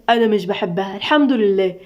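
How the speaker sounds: usual speaking voice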